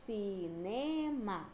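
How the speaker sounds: "Cinema" very slowly as ci, ne, ma; one syllable at a time. Shown as a demonstration. Teacher showing three syllables, "Cinema" one by one. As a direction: stretch out each syllable of "Cinema". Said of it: "eu falo cada sílaba".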